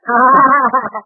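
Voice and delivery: a loud, pleased laugh at his own quip; hearty laugh. Acted. Dagwood laughs at his own joke to Charlie, a hearty laugh. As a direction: laugh out loud as if you just topped him.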